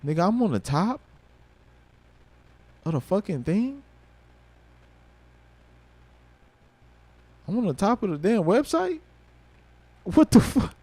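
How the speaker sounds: can't believe what he's seeing; stunned disbelief. Real, not acted. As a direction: voice jumping high in amazed disbelief. Discovers he's featured on website.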